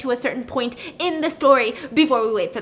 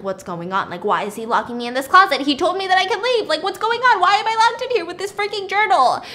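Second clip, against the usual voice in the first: mock panic building from normal voice to high and loud; retold freak-out; 7 semitones higher, 5 dB louder than usual. Woman locked in a closet with a journal, escalating mock panic. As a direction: let the imitated alarm climb steeply line by line. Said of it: "she starts freaking out".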